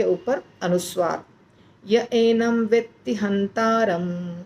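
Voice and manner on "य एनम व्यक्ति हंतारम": य एनम व्यक्ति हंतारम spoken slowly, each word laid out; slow model recitation. Shown as a demonstration. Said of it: "ऐसे".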